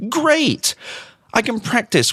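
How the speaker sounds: high, mock-delighted exclamation; sarcastic enthusiasm. Real, not acted. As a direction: toss it out with fake delight. Sarcastic praise of useless answer.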